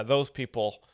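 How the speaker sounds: ordinary talk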